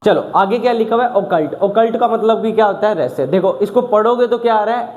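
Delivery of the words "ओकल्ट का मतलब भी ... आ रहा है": ordinary talk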